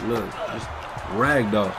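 ordinary delivery

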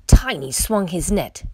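usual speaking voice

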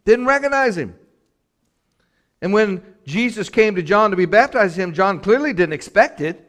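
high, loud sermon delivery; full-force preaching. Real, not acted.

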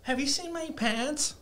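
high, soft, slow mock-innocent voice; put-on innocent girl voice; imitated clueless girl. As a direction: ask it small and high, slowly. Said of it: "this bitch has the audacity".

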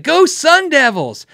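high, loud slow cheer; fan yell. Retold or imitated. Loud customer cheering in the guitar shop, shouted. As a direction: belt it like a stadium chant. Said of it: "a dude can just scream in your store".

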